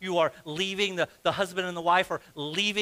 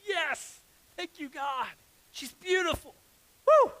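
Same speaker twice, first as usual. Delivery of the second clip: much higher, squealy hammed-up elation; Adam; 9 semitones higher than usual. Preacher as a man thanking God for his bride, giddy. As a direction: celebrate in a high, giddy voice. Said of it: "In other words, he's going".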